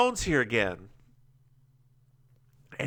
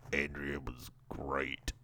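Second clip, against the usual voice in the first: put-on character voice; 11 semitones lower, 11 dB quieter than usual. Character answers about the photos, low hushed delivery. Acting the story's reply, very low.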